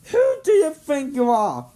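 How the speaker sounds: high, loud demanding challenge; indignant shout. Acted. Head elf confronting Jimmy, indignant loud shout. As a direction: put the kid in his place.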